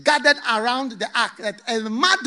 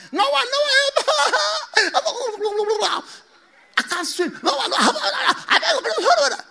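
high, laughing calls for help; drowning people call to Noah, high-pitched and laughing; desperate cries for help; 12 semitones higher, slower than usual. Acted. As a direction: cry out to Noah, half laughing.

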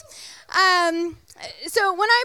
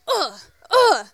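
two short effortful grunts; tennis grunt; 2 semitones higher, 5 dB louder than usual. Retold or imitated. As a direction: grunt like smashing a serve. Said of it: "the tennis grunt where you're like".

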